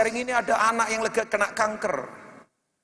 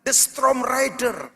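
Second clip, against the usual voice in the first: loud, driving sermon climax; rally the congregation to shout amen; rousing preaching; 5 semitones higher, 6 dB louder than usual. Acted. Preacher rallies congregation loudly. (khotbah lantang membakar semangat jemaat)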